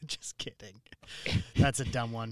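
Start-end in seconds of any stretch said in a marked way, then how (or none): 0.1-0.8 laughing whisper. Real, not acted. Laughs at own joke.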